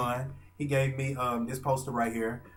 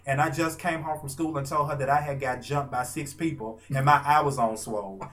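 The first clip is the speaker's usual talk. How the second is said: ordinary delivery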